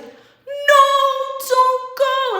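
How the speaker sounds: high, hammed-up wail; dramatic plea. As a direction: cry out loudly, melodramatically begging him to stay. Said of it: "it was so dramatic".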